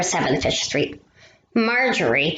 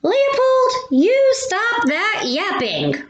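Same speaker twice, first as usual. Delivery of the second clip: yelling neighbor; 12 semitones higher, slower than usual. Acted. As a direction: shriek it out the window at the dog. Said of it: "leans out and yells".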